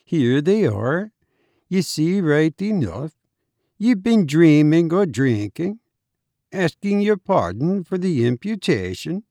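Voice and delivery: teasing Irish doctor; Irish doctor, matter-of-fact. A narrator's reading. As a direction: point it out in a singsong Irish brogue. Narrator plays the Irish doctor.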